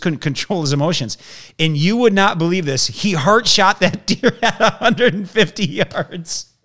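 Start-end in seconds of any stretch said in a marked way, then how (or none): 2.9-6.5 high, slow, broken by laughter; real incredulous laughing delivery; laughing in amazement. Real, not acted. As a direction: laugh through the triumphant punchline. Amazed laugh at the story's climax.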